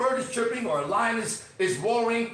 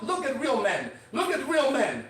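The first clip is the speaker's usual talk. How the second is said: ordinary talk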